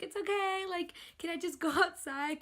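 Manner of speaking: high, tearful plea; mock-weepy high voice; retold bawling. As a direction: imitate pleading through tears. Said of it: "bawling, like, I was shaking".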